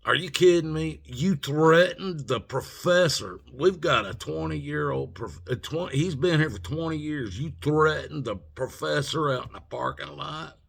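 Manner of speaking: loud, high-pitched incredulous telling-off; retold scolding. Security head confronts student over threatening professor, outraged scolding. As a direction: imitate an outraged official, voice pushed up.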